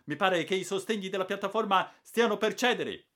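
high, loud anxious cry; old hunter warning of collapse; alarmed shout. Acted. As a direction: shout that the platform gives way.

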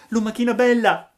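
grido acuto e fortissimo. A narrator's reading. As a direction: chiama dalla strada a squarciagola. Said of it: "gridò Pinocchio dalla strada". (Pinocchio grida alla lumaca, fortissimo e acuto)

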